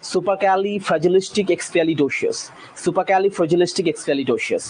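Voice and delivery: সুপারক্যালিফ্র্যাজিলিস্টিক এক্সপিয়ালিডোসিয়াস said as one smooth word; fluent demo at normal speed; run together. Shown as a demonstration. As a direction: join it all in one breath. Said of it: "একভাবে উচ্চারণ করলে".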